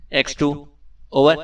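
"X ٹو" in a distinct official code voice, "over" rising; his special X2 tone. A narrator's reading. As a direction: sign on as the secret chief, formal and measured. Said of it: "Imran نے مخصوص لہجے میں کہا".

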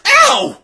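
sudden high, loud yelp of pain. Acted. Book falls on her head, yelp of pain.

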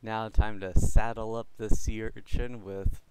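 usual speaking voice

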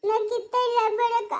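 high, dragged-out wail; tearful refusal. Acted. Crying woman sending the girl off, wailing. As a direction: push her away while crying.

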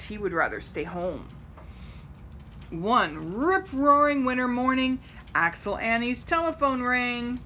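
plain reading voice

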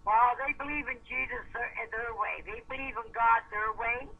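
ordinary delivery

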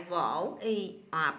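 slow reading. Shown as a demonstration. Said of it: "Cô đọc lại".

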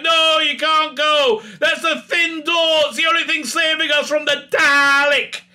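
desperate shout. Retold or imitated. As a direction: yell at him to stay put. Doctor pleads against leaving.

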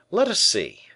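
the attentive musketeer, eager and alert. A narrator's reading. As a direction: sharpen up for the coming battle. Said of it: "said the attentive musketeer".

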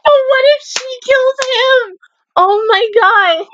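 high, squeaky worried whine; real dread. Real, not acted. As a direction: fret in a high voice, drawing it out. Dreads that a character gets killed.

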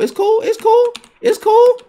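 high squeaky 'it's cool' in mock calm; sarcastic falsetto. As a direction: pitch way up, fake-chill and sarcastic. Sarcastic falsetto after plain take.

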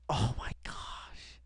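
slow, breathless whispered awe; awed whisper. Real, not acted. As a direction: whisper it, stunned.

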